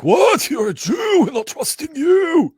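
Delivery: high, loud shout of outrage; hammed-up, high-pitched yell of disbelief; incredulous shout. Local imagined reacting to a stranger asking around, incredulous shout. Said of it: "he'll be like".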